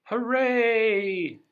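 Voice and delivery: high, loud cheer. Acted. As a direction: cheer like a whole crowd. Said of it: "cheered the crowd".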